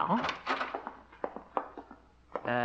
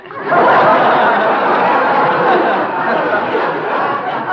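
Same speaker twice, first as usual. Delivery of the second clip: long rolling laugh, much louder; loud guffaw; 9 semitones higher, 11 dB louder than usual. Acted. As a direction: laugh big at your own pun. Laughs at his own joke.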